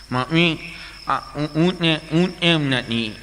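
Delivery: slow, nasal-speech retake; nasal boy. Retold or imitated. Nasal boy asks his mother about the camel again. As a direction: mumble it through the nose. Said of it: "છોકરો ગૂંગણો".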